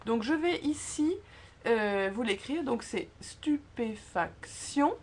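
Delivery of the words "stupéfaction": "stupéfaction" slowly, leaning on the final "tion"; stress on last syllable. Shown as a demonstration. Teacher showing tonic accent, final syllable of "stupéfaction" stressed. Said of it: "sur la dernière syllabe".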